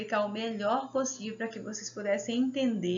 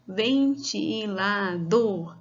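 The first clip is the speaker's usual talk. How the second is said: Ventilador drawn out slowly; slow demo word; 3 dB louder, much slower than usual. Shown as a demonstration. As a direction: stretch Ventilador for the class to count. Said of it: "vamos falar e vamos contar quantas vezes".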